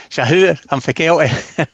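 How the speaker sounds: broad Fife accent from Kelty. Shown as a demonstration. Scottish guest asked for a sample, broad Fife accent. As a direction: lay on the broad Fife twang. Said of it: "give me a little example of that".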